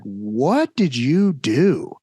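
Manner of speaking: slow, drawn-out dad question; dad, not mad. Retold or imitated. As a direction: imitate a dad asking slowly, without anger. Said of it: "wasn't even mad".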